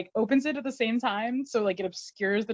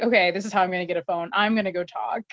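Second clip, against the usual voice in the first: fast; faster than usual. Retold or imitated. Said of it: "Nate's like".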